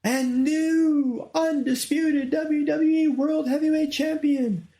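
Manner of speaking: very high, loud, slow drawn-out champion announcement; imitating a ring announcer's booming winner call, drawn out. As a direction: announce the new champion to the whole stadium. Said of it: "she's like".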